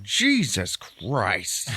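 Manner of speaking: very drawn-out appalled oath; mock disgust. Son hears about chewed caramels, disgusted. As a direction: groan it out slowly, half laughing.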